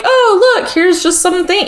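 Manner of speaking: high-pitched, airy imitation; mock delight. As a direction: chirp it while pretending to decorate. Voices woman idly decorating vision board.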